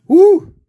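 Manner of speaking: very high, loud "Woo!"; triumphant whoop. Real, not acted. Worked-up fan celebrating the Stanley Cup puck, loud whoop. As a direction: let out a victory whoop. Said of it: "I'm all worked up right now".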